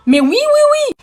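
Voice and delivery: very high, squeaky mock agreement; a high ironic squeal to the audience; mock squeaky yes. Real, not acted. Ironic boast.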